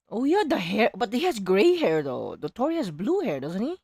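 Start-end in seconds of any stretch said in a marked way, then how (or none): none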